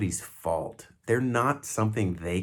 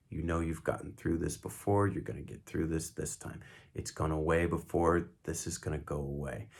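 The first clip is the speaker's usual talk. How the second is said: soft, soothing reassurance; quiet and steady, looking in their eyes; calm, repetitive; 4 semitones lower, 7 dB quieter than usual. Shown as a demonstration. Calming a friend through a panic attack, soft and repetitive. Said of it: "See what I'm doing with the voice?".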